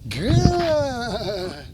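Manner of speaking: GOOD stretched long and falling; drawn-out duration marker. Real, not acted. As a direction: hold "good" out slowly to the dog. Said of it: "pause in between my good marker".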